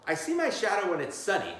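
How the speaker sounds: usual speaking voice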